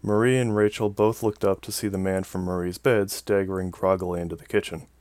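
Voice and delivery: usual speaking voice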